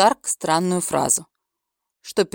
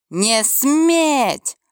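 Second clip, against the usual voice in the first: dark lord roar; 6 semitones higher than usual. Acted. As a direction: bark it like an enraged lord. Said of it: "рявкнул он".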